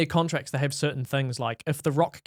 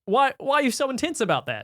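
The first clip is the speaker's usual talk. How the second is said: high-pitched incredulous question; mock bafflement; 10 semitones higher than usual. Host questioning the actor's intensity, high mock bafflement. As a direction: pitch it up in disbelief.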